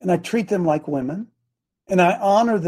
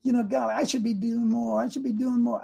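lightly voiced wife, higher and softer; frustrated wife, imitated; 4 semitones higher, 8 dB quieter than usual. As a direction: imitate a wife quietly doubting herself. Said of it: "sometimes she gets frustrated and says".

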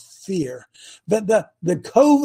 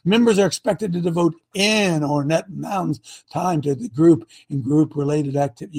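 ordinary talk

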